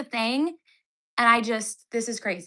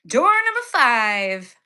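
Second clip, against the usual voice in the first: mock game-show announcer; 5 dB louder, slower than usual. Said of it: "announcer voice for the door".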